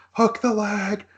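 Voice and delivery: high-pitched imitation of a screaming commentator; Don Callis screaming. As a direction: mimic a frantic scream without real volume. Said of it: "Don Callis screaming".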